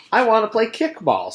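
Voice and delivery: put-on high, eager kid voice; whiny little boy begging; lightly voiced eager child; Ron pleading.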